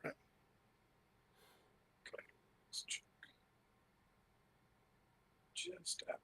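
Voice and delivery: whisper. Real, not acted. Muttering while measuring.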